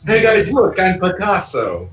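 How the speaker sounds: lightly voiced Bill proudly presenting himself; mock Bill voice. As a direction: show off your ruined face like a joke. Mocking burned Bill's face.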